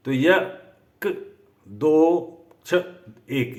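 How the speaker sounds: यक drawn out very slowly, then क्ष short; syllable split. Shown as a demonstration. Teacher counting syllable weights of यक्ष, very slow. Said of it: "यक्ष में यक दो दीर्घ कर दिया".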